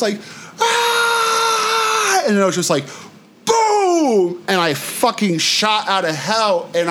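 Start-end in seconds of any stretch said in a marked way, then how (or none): 0.6-2.2 high screaming cry. Retold or imitated. He reenacts his escape-from-hell scream, high and crying. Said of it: "stop screaming". 3.5-4.4 boom. Retold or imitated. Sound effect of shooting out.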